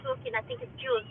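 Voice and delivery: usual speaking voice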